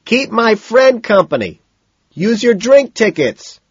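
high, loud holler; loud call over the music; shouted instructions. A narrator's reading. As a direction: yell orders while leaving. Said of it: "Madison shouts".

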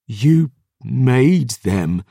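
slow, heavy, tearful accusation; slow bitter accusation. Acted. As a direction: drag each word out with grief. Brother in tears to statue.

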